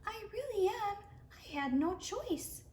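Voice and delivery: a small voice pleading its case; little voice. Acted. The Snatch-a-Book pleading with Eliza in a high little voice. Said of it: "came a little voice".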